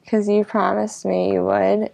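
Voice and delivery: ordinary delivery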